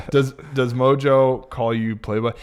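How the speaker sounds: ordinary delivery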